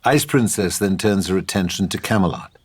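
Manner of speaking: ordinary talk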